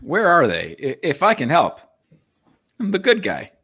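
ordinary delivery

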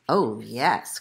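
low, gloating fox voice; lightly voiced scheming fox; fox crying out. A narrator's reading. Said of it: "cried the fox".

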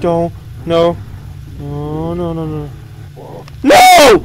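panicked high pleading; frantic begging as it nears; terrified gibbering. Real, not acted. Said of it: "I'm honestly scared right now".

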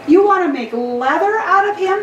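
put-on the boy recoils at the tanner's plan; shocked cry. A narrator's reading. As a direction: blurt it out in disbelief. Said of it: "the boy cried".

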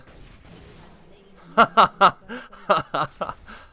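loud, high ha-ha-ha burst; big choppy gamer laugh; staccato laugh. Real, not acted. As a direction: laugh out in short, punchy bursts. Gamer laughs after enemy escape.

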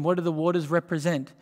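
ordinary talk, nothing marked

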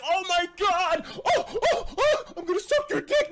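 high, loud, gushing squeal of a starstruck fan; mocking a starstruck fan, squealing high and loud; overexcited fanboy. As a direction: shriek it like a fan losing it. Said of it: "Don't be like".

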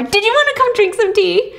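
high, bright eager invitation; lightly voiced excited neighbor. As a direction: sound thrilled to have company.

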